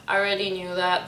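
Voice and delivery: normal talking voice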